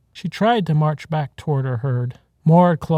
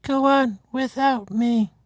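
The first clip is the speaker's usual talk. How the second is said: slow, high, dying farewell; last words, slow and pitched up; final bleat; 10 semitones higher, slower than usual. A narrator's reading. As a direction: herd mother dying, urging the herd on. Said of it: "she bleated out one final time".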